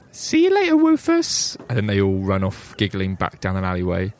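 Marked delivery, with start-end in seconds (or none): 0.1-1.6 high, cheerful urchin voice; cheery kid saying goodbye, much higher; street urchin. Acted. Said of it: "it just says".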